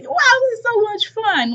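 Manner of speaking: high, giddy mock delight; mock gleeful zombie. Host mimicking the veteran having fun killing, mock glee. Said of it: "laughing and shit".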